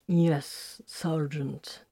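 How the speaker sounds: reluctant, tight-jawed assent; through gritted teeth. A narrator's reading. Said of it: "Titus managed through gritted teeth".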